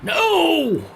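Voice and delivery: high, loud mock anguish; dramatic no. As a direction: cry out in hammy despair. Mock dramatic death cry.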